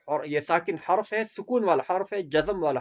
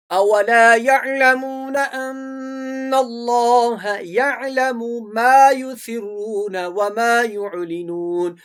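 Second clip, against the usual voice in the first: loud, raised, very slow chant; Quran recitation; 4 semitones higher, 7 dB louder, much slower than usual. Shown as a demonstration. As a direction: recite out strongly, drawing out every long vowel. Teacher recites model verse. (بلند آواز میں ٹھہر کر تلاوت)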